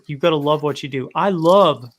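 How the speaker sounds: ordinary talk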